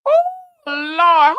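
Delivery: real laugh on Lord; laughing cry. Real, not acted. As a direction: Lord laughed out half crying. Laughing in disbelief.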